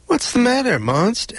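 put-on high, light boy's voice; childlike astronaut voice. Astronaut boy asking the sad monster, high and light. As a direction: ask gently, a small boy's concern.

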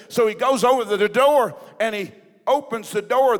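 loud, emphatic revival storytelling; impassioned preaching. Acted. Preacher telling church of a pastor's prison escape, impassioned. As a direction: preach the suspense with punched key words.

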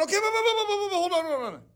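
acted-out nervous stalling; hesitant objection. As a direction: stall them with a stream of hold-ons. People balking at entering the land.

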